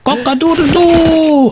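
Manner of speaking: high pretend rooster crow; cockerel crowing. Cockerel announcing time to wake up, high crowing. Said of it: "see what Mister Cockerel has to say".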